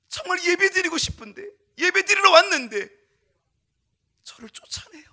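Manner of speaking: put-on high, loud anguished plea of a wronged boy; pleading child. Boy telling Jesus he was thrown out, loud high pleading. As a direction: cry out the hurt of being shut out.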